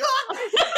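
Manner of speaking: high, loud startled yelp; mock startled yelp. Gardener caught staring at her, high startled yelp. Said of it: "He's like".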